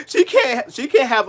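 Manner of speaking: heated outburst, pitch climbing; real anger boiling over; getting mad. Real, not acted. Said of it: "now I'm getting mad".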